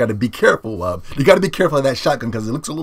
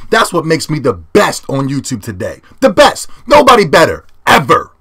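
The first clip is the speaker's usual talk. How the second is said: grand boast; 6 semitones higher, 7 dB louder than usual. Real, not acted. Grand self-praise to viewers.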